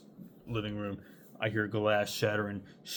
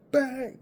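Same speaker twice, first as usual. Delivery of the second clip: imitated bang; 6 dB louder than usual. Imitating gunshot noise.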